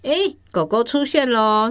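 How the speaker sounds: ordinary delivery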